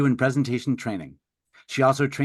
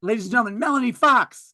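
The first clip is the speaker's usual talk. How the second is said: big, higher announcer build-up; 9 semitones higher, 4 dB louder than usual. Acted. Host introducing the speaker to the audience, announcer voice. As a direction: call her on like a game show host.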